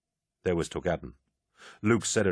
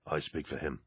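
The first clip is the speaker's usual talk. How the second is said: low solemn voice; 4 semitones lower, 11 dB quieter than usual. Acted. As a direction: lower and softer, solemn. Aximand vouching at ritual.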